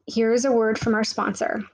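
ordinary delivery

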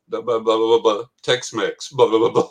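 loud rattled-off gibberish babble; imitated interpreter. Interpreter relaying a fan's Spanish to the guitarist, imitated babble. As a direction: rattle off mock translation, punchy and loud. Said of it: "the interpreter goes".

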